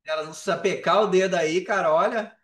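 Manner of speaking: teasing loud warning; mock threat. Playful threat to cut the group.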